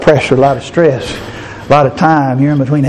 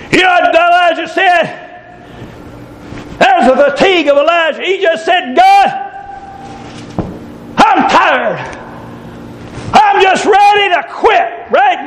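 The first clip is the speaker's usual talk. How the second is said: high, shouted preacher voice crying out Elijah's complaint; preaching at full force; 13 semitones higher than usual. Acted. Preacher voicing tired Elijah to the church, shouted preaching. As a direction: cry it out to the church.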